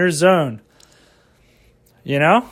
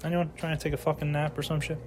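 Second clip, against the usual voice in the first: hushed; 12 dB quieter, faster than usual. Real, not acted. Checks if anyone is home.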